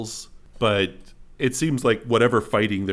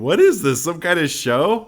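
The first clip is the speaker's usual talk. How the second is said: higher, louder scoffing question; mocking crowd; 6 semitones higher, 5 dB louder than usual. Amused crowd watches the falling magician, raised mocking call. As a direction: heckle as an amused onlooker. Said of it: "everyone kinda starts chuckling and laughing".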